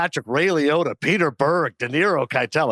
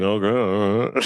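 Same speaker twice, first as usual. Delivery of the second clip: mumbled, lower impression; Sly Stallone; 6 semitones lower than usual. Actor answering his director's tough talk, mumbling impression. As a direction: mumble it away like a movie tough guy. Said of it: "Just mumbling away".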